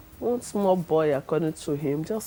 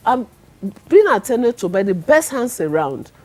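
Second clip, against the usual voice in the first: loud, indignant retort at the pastor; retold angry outburst; 5 semitones higher, 11 dB louder than usual. Wife snapping back at the pastor who came to anoint her, loud. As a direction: tell him off, voice raised high.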